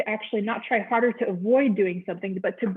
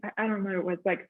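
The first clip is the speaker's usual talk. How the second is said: ordinary delivery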